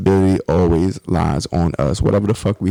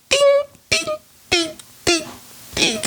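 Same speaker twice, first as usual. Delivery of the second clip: high sung bell dings; ding it like a little bell; mock bell dings. Mimicking a bell sound.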